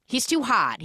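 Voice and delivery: normal talking voice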